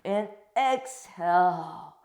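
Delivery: long, loud, slow exhale cue; drawn-out exhale cue. Shown as a demonstration. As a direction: stretch the exhale out to lead the breath.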